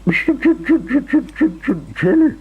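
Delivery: high, frightened stutter on the J; stammering. A narrator's reading. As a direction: stammer the name in fear. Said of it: "stammered Mister Jelly".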